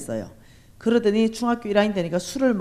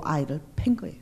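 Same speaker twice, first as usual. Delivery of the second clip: shocked whisper; 4 semitones lower, 5 dB quieter, faster than usual. Real, not acted. Shocked story of beating.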